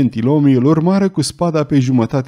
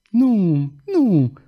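put-on higher, frightened girl's no; terrified denial; 7 semitones higher than usual. A narrator's reading. Singer answering the duke, high and terrified. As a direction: push him away in fear. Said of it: "răspunse ea îngrozită".

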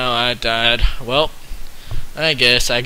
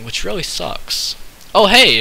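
normal talking voice

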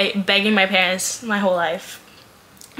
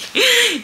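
high giddy laugh; 14 semitones higher than usual. Real, not acted. Giddy over new Kindle.

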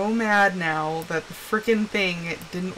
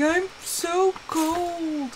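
higher, softer, very drawn-out mock whine; imitated bellyaching; 6 semitones higher, 5 dB quieter, much slower than usual. Wife imitating husband walking around cold, slow mock whine. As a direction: moan it slowly like a complaining husband. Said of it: "He has been belly aching".

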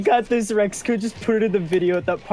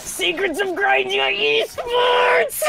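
high drawn-out cheer; 14 semitones higher, much slower than usual. Real, not acted. Triumphant cheering.

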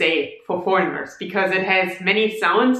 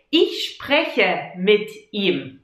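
slow, careful Ich spreche mit ihm; each word of Ich spreche mit ihm laid out slowly; slow example; 2 semitones lower, slower than usual. Shown as a demonstration. Teacher modelling the second example slowly. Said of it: "But you can also say".

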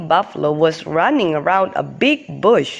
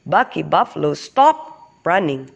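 ordinary delivery

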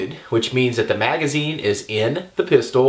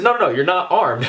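higher-pitched, insistent correction; retold contradiction; 6 semitones higher than usual. Speaker corrects retired officer about being armed, retold contradiction. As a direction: shoot down his claim with repeated noes.

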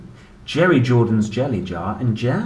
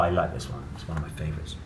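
ordinary delivery, nothing marked

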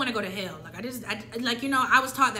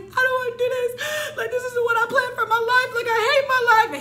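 mock sobbing; 13 semitones higher, 8 dB louder than usual. Sobbing to dad in the car, hammed-up wailing. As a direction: wail it like a crying child.

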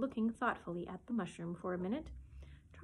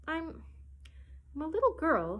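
high, halting, unsure answer; hesitate, then offer it doubtfully on a raised pitch; rather doubtful; 7 semitones higher than usual. A narrator's reading. Alice tells the pigeon what she is, doubtful. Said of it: "rather doubtfully".

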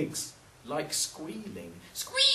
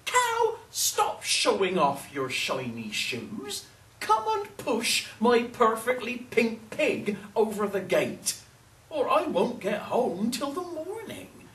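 lightly voiced old woman, urging; high call, then an insistent plea; lightly acted storybook scolding; pleading old woman; 7 semitones higher, 4 dB louder than usual.

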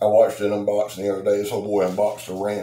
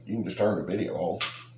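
usual speaking voice

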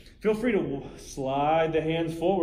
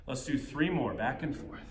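winded, breathing hard mid-workout; short of breath between moves; 7 dB quieter than usual. Real, not acted. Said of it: "Allow me to catch my breath".

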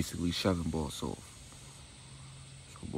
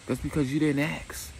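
sassy high retort; 8 semitones higher, 4 dB louder than usual. Retold or imitated. Victoria's retort.

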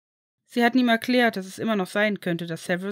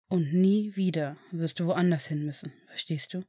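gentle father; 3 semitones lower than usual. Acted. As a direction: promise him he is safe now. Father reassures adopted son. (tiefer, ruhiger, beruhigender Vater)

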